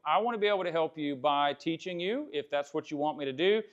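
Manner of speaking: ordinary delivery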